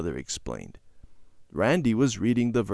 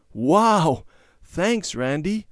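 delighted; 8 semitones higher, 5 dB louder, much slower than usual. A narrator's reading. Harry delighted by gift.

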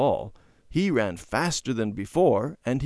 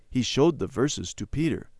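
ordinary delivery

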